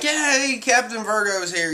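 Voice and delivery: high-pitched mock fan cheer. G-5 men hailing their captain's arrival, mock cheering.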